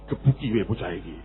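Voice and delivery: grandfather in Javanese. Retold or imitated. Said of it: "gantian konge".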